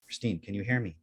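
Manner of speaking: soft, lightly voiced voice in someone's head; a hint of a mysterious inner voice; disembodied voice. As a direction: quietly call to her from inside her head. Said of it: "like, this voice".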